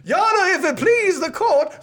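put-on high, laughing southern lawyer accent. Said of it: "hard thing to do with a southern accent".

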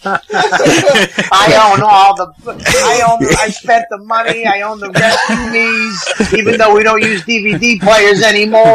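high, drawn-out mock outburst; mock indignant. Mocking his own speech about owning the seasons, high and indignant. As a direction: plead your case in hammed-up indignation.

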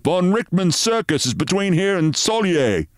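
worried sergeant. Acted. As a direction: report it tensely to the captain. Sergeant voiced in story.

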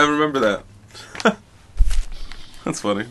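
ordinary delivery

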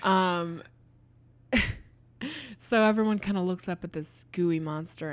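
ordinary talk

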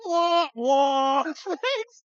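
high, slow drawn-out wah wah; general's helpless shrug as a high drawn wah; mock shrug noise. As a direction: make a silly helpless shrug sound. Imitating Harrell's shrug.

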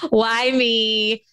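self-pitying whine, played for laughs; a hammed-up poor-me wail; half-joking woe-is-me; mock victim. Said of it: "pure, like, victim".

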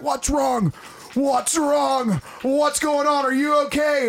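dad rushing in, panicked; alarmed dad. Retold or imitated. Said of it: "He's like".

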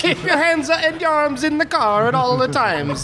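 put-on high, bouncy Italian-accent announcer; mock Italian ride announcer. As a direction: announce it like Luigi's ride host.

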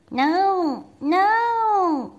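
high, loud wail of protest; little frog loudly refusing to sleep; crying out. Acted. Froggy protesting to his mother, crying out loud. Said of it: "cried Froggy".

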